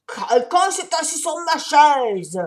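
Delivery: papa bear yelling about his chair. A narrator's reading. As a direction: bellow it out. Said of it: "hurla Papa Ours".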